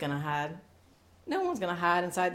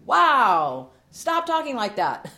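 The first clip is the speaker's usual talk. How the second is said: mock rebuke; 8 semitones higher, 9 dB louder, much slower than usual. Rebuking self-talk.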